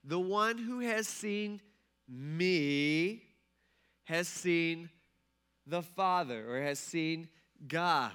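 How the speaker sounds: very slow, with a pause between phrases; mock-patient, word by word explanation; slow and deliberate. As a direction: spell it out for a slow listener. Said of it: "let me say this slower for you".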